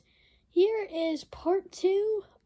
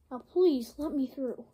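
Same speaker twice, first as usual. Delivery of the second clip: usual speaking voice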